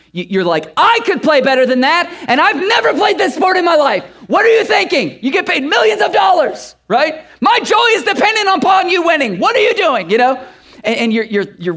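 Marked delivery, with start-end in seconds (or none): 0.8-7.2 high, loud frustrated fan shout; yelling at the TV. Retold or imitated. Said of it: "you're yelling at the T V". 7.4-10.1 high, loud frustrated fan shout; yelling at the TV. Retold or imitated. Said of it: "you're yelling at the T V".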